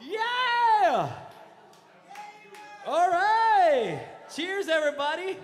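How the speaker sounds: rally the room with a toast; excited cheer. Real, not acted. Toasting the crowd at karaoke.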